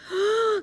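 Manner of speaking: sharp, high intake of breath; delighted gasp. A narrator's reading. Eddie discovering the buffet food, delighted gasp.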